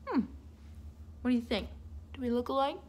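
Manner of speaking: ordinary delivery, nothing marked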